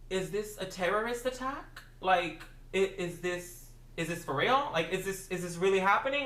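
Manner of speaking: low, halting, softened questioning; mock-uneasy disbelief. Diner looking at steaming buffet food, mock-alarmed and hushed.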